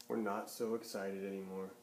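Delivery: a sinking, let-down finish; narrating the pigs losing their excitement; deflated. A narrator's reading. Pigs disappointed.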